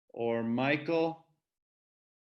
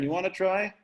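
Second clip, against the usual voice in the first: ordinary talk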